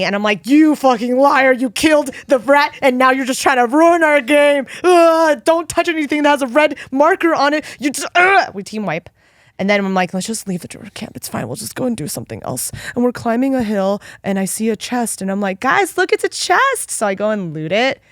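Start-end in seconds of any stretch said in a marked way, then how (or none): 0.5-8.1 higher, louder retold rant at a friend; mock furious yelling. 8.2-8.5 frustrated groan. Retold or imitated. Frustrated groan ending rant. 10.1-12.7 resigned mumble. Retold or imitated. Giving up on druid camp. 15.6-16.9 much higher, eager call to the group; mock excited. Spotting a chest.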